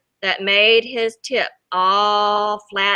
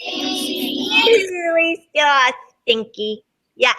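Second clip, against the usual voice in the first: higher, slow disgusted groan; disgusted sock voice; 6 semitones higher, much slower than usual. Acted. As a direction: drag out the yuck. Sock complains about smell.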